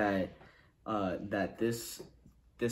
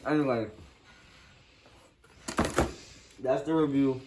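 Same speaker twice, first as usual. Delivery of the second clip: talking while chewing; words muffled by food; mouth full of pizza; 2 semitones higher, 3 dB louder than usual. Real, not acted. Seen 1: Bites big slice at 0.1–1.6, then chews while talking.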